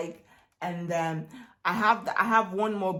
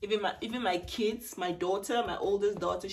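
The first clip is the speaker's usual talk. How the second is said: normal talking voice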